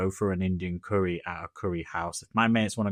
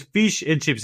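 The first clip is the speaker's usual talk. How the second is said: louder mock Indian accent, higher; 7 semitones higher, 6 dB louder, slower than usual. Waitress repeating the dish again, mock Indian accent. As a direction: imitate the waitress once more. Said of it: "She goes".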